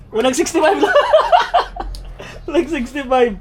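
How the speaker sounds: giggling through the words; laughing. Real, not acted. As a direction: laugh while reading the number. Laughing at scale reading.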